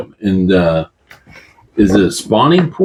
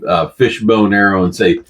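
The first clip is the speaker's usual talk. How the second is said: usual speaking voice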